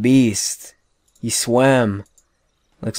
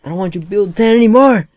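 loud, fast, high protest; fast exasperated high shout; frustrated yell; 9 semitones higher, 10 dB louder, faster than usual. Real, not acted. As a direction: shout at the stubborn worker. Yelling at worker.

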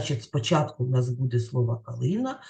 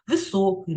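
"високий" with the stress on СО; "високий" said as ви-СО-кий; stress on second syllable; 3 semitones higher than usual. Shown as a demonstration. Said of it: "на другому".

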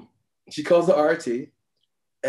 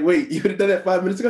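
higher and fast, rushing out in disbelief; incredulous; 7 semitones higher, much faster than usual. Retold or imitated. Rookie doctor realizing the nurse was ahead, fast and incredulous. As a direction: blurt it out, caught completely off guard. Learns nurse would have called.

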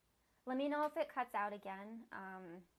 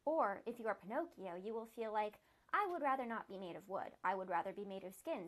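steady read-aloud storytelling voice; story narration; 5 dB louder than usual. A narrator's reading. Reader telling the fox's story, even narration. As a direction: read the fox's tale plainly to listeners.

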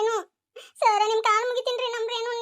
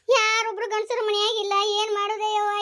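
normal talking voice

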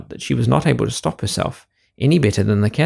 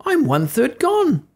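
very high, slow, squeaky lament; tiny cookie sadly counting his loss; gingerbread man voice; slower than usual. Acted. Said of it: "said the gingerbread man".

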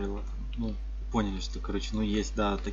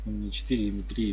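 ordinary talk, nothing marked

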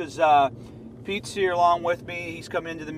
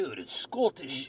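put-on, lower Scottish hello; bad Scottish accent; 4 semitones lower, 4 dB quieter than usual. Boss defending his accent, bad Scottish accent. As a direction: greet in a dubious Scottish accent. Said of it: "It's kinda part Scottish".

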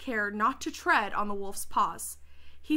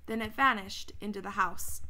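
ordinary delivery

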